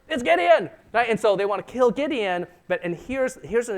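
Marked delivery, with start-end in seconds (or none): 0.1-0.7 accusing cry. Retold or imitated. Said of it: "They're like".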